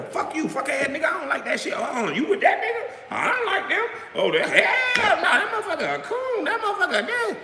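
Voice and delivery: mocking schoolgirls. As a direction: ham up the catty gossip, cackling between insults. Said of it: "like a bunch of schoolgirls".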